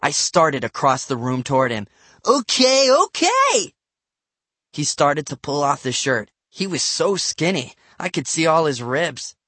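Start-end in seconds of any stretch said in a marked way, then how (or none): 2.2-3.7 high, squealed protest; alarmed kid voice; flustered giving-in. A narrator's reading. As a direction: give in hastily, pitched way up. Boy giving in under pressure.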